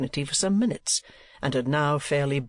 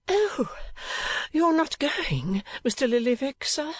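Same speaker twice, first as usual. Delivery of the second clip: put-on much higher, slow coaxing young lady voice; bewitching smile; 12 semitones higher, slower than usual.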